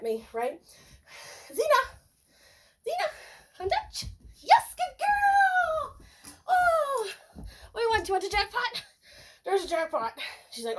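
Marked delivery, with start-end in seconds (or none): 1.5-4.1 calling the dog. Real, not acted. Calling the puppy. 4.4-7.1 very high, loud, drawn-out praise; gushing praise. Real, not acted. Trainer rewarding puppy for coming, gushing high praise. 7.4-10.2 high, sing-song talk to a puppy. Real, not acted. Trainer offering her puppy a jackpot, high baby talk.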